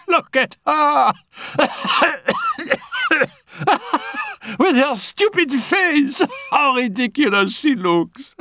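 high, laughing jeer; mocking laughter. Annette jeers at kneeling Félicie, high and laughing.

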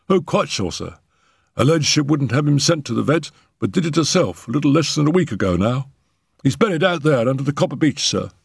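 high, quick servant voice; eager, deferential and talkative up high; footman. Acted. Footman assuring the doctor about the buried cat, high and quick.

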